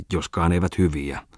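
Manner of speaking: ordinary delivery, nothing marked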